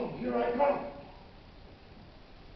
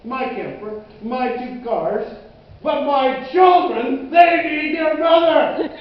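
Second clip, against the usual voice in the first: slow, loud listing building to a shout; desperate plea; 5 semitones higher, 13 dB louder, slower than usual. Acted. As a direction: plead harder until you're shouting. Desperate plea for his family.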